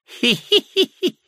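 very high, loud creepy tittering; high old man giggle. A narrator's reading. (мерзкое старческое хихиканье)

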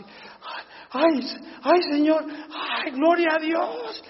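ayes muy agudos de asombro; asombro gozoso. Retold or imitated. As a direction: como quien ve un milagro, gritando de gozo. Hombre sanado, asombrado y alegre. (el hombre sanado exclama asombrado, muy agudo)

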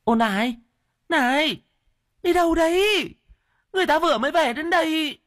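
high, fast wife's call; calling after them. A narrator's reading. Wife calls after her husband rushing off, high and fast. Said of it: "gọi với".

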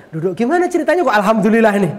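high, loud, fast questioning; excitedly retold bafflement, rushed and high; baffled demand. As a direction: blurt it out in amazed curiosity. Baffled, demands the inmate's story.

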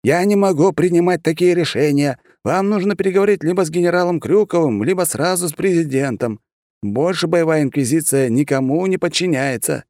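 a harried clerk repeating himself; flustered official. A narrator's reading. Official explains to foreigners.

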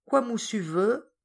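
a hint of a timid servant; servant's accented voice.

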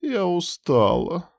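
drowsy, drawn-out murmur; sleepy drawl. Acted. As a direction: stretch the name out half asleep. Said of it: "сонно протянула та".

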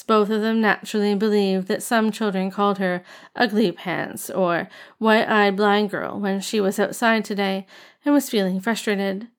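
plain reading voice

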